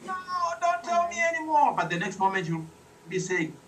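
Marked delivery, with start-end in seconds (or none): 0.1-1.7 high laughing no-don't-tell-me; playfully horrified listener; mock listener protest. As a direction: squeal it like a thrilled scared kid. Listener reacting to scary story.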